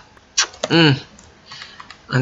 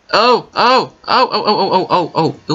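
high, loud rapid oh's, fading with a laugh; rising thrill that tapers into laughter; excited oh-oh-oh; 6 semitones higher, 4 dB louder than usual. Real, not acted. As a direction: bubble over with giddy excitement. Finds something in game.

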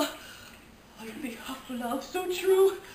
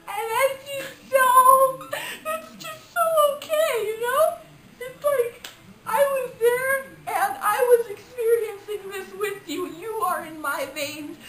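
mock tearful gushing; 11 semitones higher, 5 dB louder than usual. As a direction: overcome with emotion, hammed up. Mock tearful, overcome movie reviewer.

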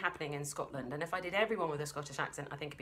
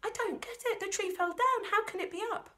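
put-on much higher, puzzled child's voice; a kid who can't make sense of it; baffled child; 5 dB louder than usual.

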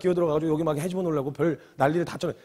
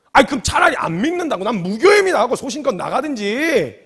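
loud, higher-pitched indignant rant; retold angry outburst; 4 semitones higher, 6 dB louder than usual. Preacher fuming at a half-hearted congregation, angry outburst. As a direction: burst out as if disgusted, reliving the anger. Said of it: "너무 화가 나".

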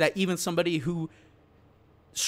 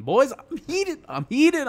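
higher, drawn-out heated complaint; 8 semitones higher, 4 dB louder, slower than usual. Real, not acted. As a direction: let them hear you're fired up. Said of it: "I'm genuinely mad about this one".

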